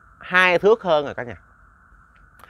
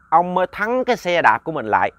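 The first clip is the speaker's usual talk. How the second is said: plain reading voice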